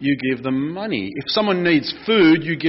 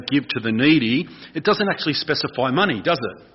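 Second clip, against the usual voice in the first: usual speaking voice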